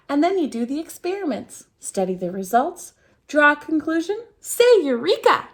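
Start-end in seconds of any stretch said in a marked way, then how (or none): 4.4-5.5 high, loud triumphant cry; joyful eureka; excited cheer. Acted. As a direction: shout it like a breakthrough. Esther cheers her discovery.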